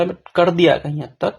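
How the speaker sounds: ordinary talk, nothing marked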